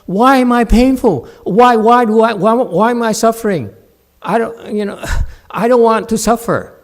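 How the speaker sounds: loud, high mocking whine; hammed-up complaining student; mock whining. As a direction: parody a student's self-pity. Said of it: "But you said".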